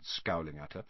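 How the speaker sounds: usual speaking voice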